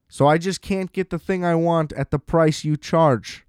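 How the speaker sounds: ordinary delivery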